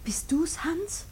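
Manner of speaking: voiceless whisper into the dark; whispered in the dark to her husband; anxious whisper. Acted. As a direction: barely breathe the question. Woman hears someone come in at night.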